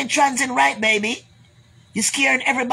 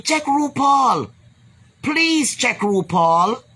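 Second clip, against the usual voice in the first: emphatic plea; 2 semitones higher, much slower than usual. Real, not acted. Urging viewers to copy [name].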